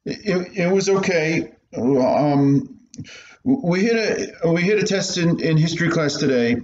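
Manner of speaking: put-on a scared kid hiding something from his mother; nervous young boy. Boy stammering to his strict mother.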